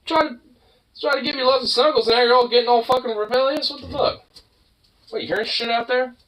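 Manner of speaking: high-pitched pet voice; squeaky talk to the dog. Real, not acted.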